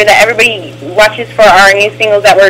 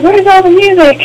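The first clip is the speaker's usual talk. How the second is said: confused listener; 11 semitones higher than usual. Retold or imitated.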